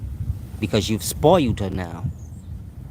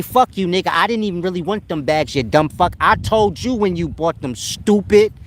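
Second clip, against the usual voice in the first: mock angry girlfriend; 6 semitones higher, 3 dB louder than usual. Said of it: "She say".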